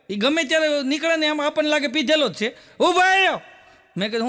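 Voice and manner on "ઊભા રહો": calling out. Retold or imitated. Drunk stops the narrator in street.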